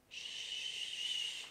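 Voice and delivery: soft whispered shh; shush. Shown as a demonstration. Teacher shows the kids how to hush, whispered. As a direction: hush them gently. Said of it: "La bebé está durmiendo".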